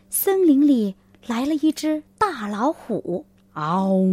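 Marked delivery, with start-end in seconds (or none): 3.5-4.0 short growling tiger roar. Acted. Tiger arrives in forest.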